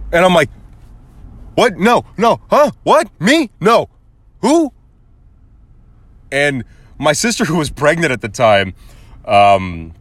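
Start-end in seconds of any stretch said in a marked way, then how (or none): 1.6-4.7 high-pitched, stammered mock innocence; comic squeaky protest; flustered denial. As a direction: play caught out, little startled bursts. Acts out flustered denial when accused.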